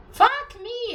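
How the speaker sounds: loud, high Fuck me in disbelief; exasperated curse. Real, not acted. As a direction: blurt it out, fed up. Ranting about lax scythe rules.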